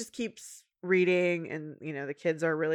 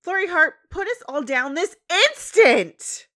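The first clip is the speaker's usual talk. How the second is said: scolding Twilight; 11 semitones higher, 9 dB louder than usual. Retold or imitated. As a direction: put your foot down, loud.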